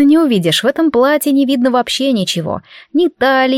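ordinary delivery, nothing marked

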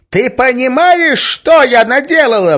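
high, loud hysterical shriek. A narrator's reading. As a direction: shriek it out in horror. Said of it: "взвизгнула Арина". (истеричный визг)

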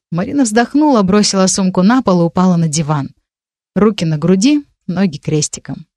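ordinary delivery

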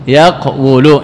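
"يقول" said as yaq-WU-lu, with a closed first syllable; underlying form yaqwulu. Shown as a demonstration. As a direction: say "يقول" with silent qaf, then a sounded wu. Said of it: "يقول أصلها". (نطق «يقول» على الأصل: يَقْوُلُ)

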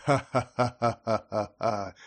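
slow, hollow, lifeless laugh; indifferent laughter. Acted. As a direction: laugh as if only playing a part. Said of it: "расхохотался... смех его тоже оставался каким-то равнодушным".

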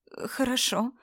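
hesitant, stumbling word; faltering. Acted. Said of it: "запнулась Анна на слове".

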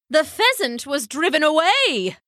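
high-pitched, affronted bird voice; scandalised dove. Acted. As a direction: voice a dove aghast at the news. Dove shocked at what happened.

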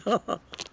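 a short, high, falling laugh of scorn; mocking laugh. Baron laughing at the narrator's outing with his cousin, mocking. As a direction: laugh down at the young man.

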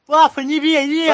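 high, loud frightened plea; pleading yell. Pretend. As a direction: beg not to be hit. Son begging father in skit.

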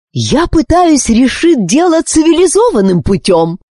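high-pitched exasperated plea; queen at her wits' end; desperate exclamation. A narrator's reading. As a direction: cry out in despair. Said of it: "в отчаянии воскликнула королева".

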